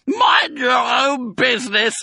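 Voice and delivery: high, loud, drawn-out rebuff; furious shriek. A narrator's reading. Drunk Rat rebuffs Mister Fox, shrieking. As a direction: screech each word indignantly. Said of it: "shrieked Rat".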